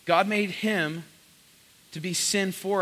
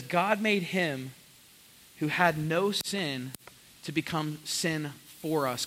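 slow, deliberate verse; slow scripture reading; slower than usual. Acted. Pastor reading verse twenty-one to the church, slow. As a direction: read each phrase with weight. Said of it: "reading verse slowly".

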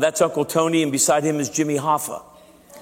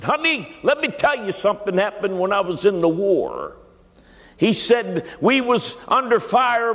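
put-on much higher, slower old war veteran voice; Uncle Tony voice; 8 semitones higher than usual. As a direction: tell his war story as the old veteran, pitched way up and slow. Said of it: "I wanna tell you the story in his voice".

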